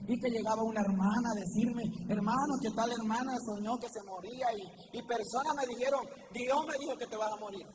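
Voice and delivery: quiet. Real, not acted. Quiet retelling of fear of death.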